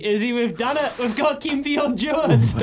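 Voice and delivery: high, laughing cheer of triumph; crowing with delight, breaking into a laugh; excited. Real, not acted. Host cheering to co-host about landing the guest, excited and laughing.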